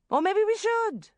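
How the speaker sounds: high, loud, mischievous suggestion; wicked glee. A narrator's reading. Sharon plotting to go back to the banker, gleeful. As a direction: pounce on a wicked new idea. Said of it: "An evil gleam came into her eye".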